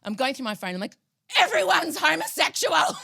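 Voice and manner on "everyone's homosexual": loud laughing cry; laughing shout. Retold or imitated. Laughing exclaim at phone contacts.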